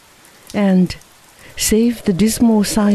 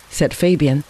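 ordinary delivery, nothing marked

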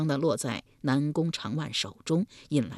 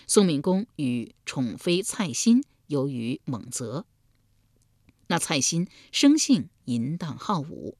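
plain reading voice

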